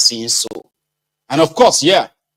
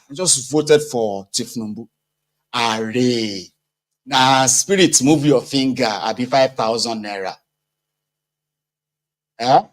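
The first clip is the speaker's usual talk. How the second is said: ordinary talk, nothing marked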